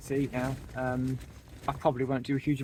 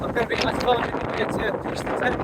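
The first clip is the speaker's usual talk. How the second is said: loud, raised voice in gale; shouting over wind; 7 semitones higher, 5 dB louder than usual. Real, not acted. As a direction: call out over howling wind. Windy summit, shouting over gusts.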